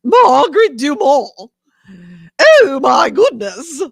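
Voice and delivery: put-on high, loud, drawn-out old-movie dame; Margaret Dumont. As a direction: exclaim it like a scandalized grande dame. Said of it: "impersonation that I can just nail".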